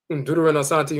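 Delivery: usual speaking voice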